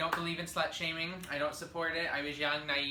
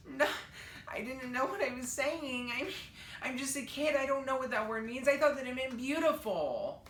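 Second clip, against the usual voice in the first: much higher, whiny fake-tearful plea; fake crying; 8 semitones higher than usual. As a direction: put on tears to dodge trouble. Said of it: "I threw on my fake tears".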